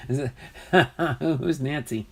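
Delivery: asked through a laugh; giggling disbelief at the name. Real, not acted. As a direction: let the laugh spill into the question. Repeats question while laughing.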